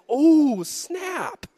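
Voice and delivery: high-pitched drawn-out reaction; mock stunned realization. Preacher retelling being hit by the point, stunned. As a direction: react as if the point just landed.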